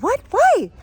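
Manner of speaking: high, loud, baffled reaction; startled questioning. Retold or imitated. Woman answers friend who is mad, high startled question. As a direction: jump up in pitch, taken aback.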